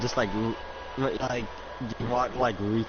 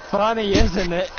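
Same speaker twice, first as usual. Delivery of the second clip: louder, high, amused taunt; laughing villain; 9 semitones higher, 6 dB louder than usual. Acted. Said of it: "and he's just laughing".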